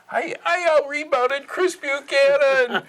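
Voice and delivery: high-pitched, loud, drawn-out brag; gleeful retold shout of triumph; crowing boast. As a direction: crow it in mock triumph, way up high. Retold boast after getting a rebound.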